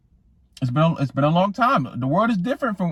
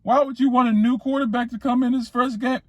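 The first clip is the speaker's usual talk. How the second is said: mocking critics; 11 semitones higher than usual. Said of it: "everyone's like".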